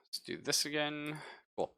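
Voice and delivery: ordinary talk, nothing marked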